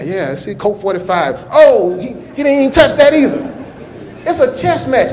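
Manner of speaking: ordinary talk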